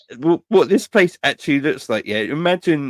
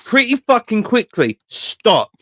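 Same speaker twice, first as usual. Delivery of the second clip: high-pitched mock alarm; acted-out urgent warning to the kids; retold parent's warning; 11 semitones higher than usual. Parent answering kids in a story.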